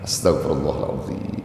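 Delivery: quiet, gentle Astagfirullahaladzim; soft heartfelt istighfar. Shown as a demonstration. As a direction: present the heart as you say it. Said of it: "dengan cara yang lembut tadi". (istighfar lembut menghadirkan hati)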